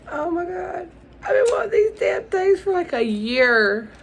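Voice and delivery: very high, emotional outburst; overwhelmed excitement. Real, not acted. Overwhelmed by long-wanted gift.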